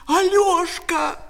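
high, loud, drawn-out cry of a name; angry shout. Acted. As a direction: yell for the culprit at the top of your voice. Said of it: "закричала Катя".

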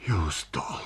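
soft, whispery weary murmur; faint, worn-out voice; exhausted. A narrator's reading. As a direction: breathe it out, drained. Pale, exhausted painter speaks.

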